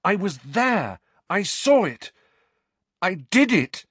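high, forceful, emphatic phrases; furious insistence. A narrator's reading. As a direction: insist desperately on being believed. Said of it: "said Harry furiously".

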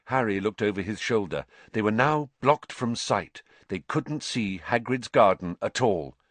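plain reading voice

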